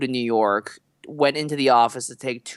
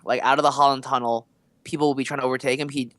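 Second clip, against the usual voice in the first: ordinary talk, nothing marked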